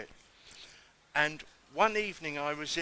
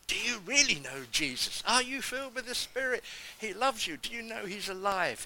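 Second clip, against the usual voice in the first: higher-pitched, bold questioning; eager urging; 7 semitones higher than usual. Retold or imitated. New convert urging customers in the shop, boldly eager. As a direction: press the good news on them eagerly.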